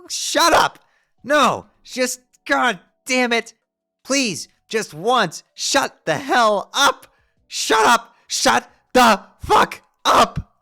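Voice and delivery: very loud, high, slow screams; Karkat-style screaming. Acted. Jade screaming at her self-prototype to shut up, enraged screams. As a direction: scream it out at full force. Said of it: "Jade has turned into Karkat".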